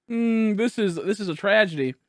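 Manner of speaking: normal talking voice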